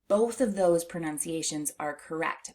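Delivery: ordinary talk, nothing marked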